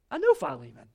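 a knowing, surprised "I know him"; Paul's surprised realisation. Retold or imitated. Paul telling Onesimus he knows Philemon, lightly surprised. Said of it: "Paul goes".

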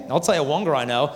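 ordinary delivery

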